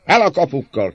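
high, loud, impatient demand; shouting. A narrator's reading. Rioter rattling the iron bars, shouting. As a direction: shout for the gates to open. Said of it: "kiáltott ismét az előbbi".

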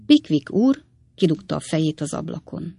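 usual speaking voice

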